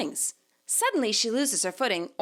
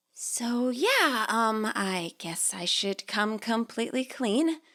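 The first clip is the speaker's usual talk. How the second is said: hesitant, slow girl's voice, lightly voiced; a sheepish admission, eased out; lightly voiced nervous girl coming clean; shy confessing girl; slower than usual.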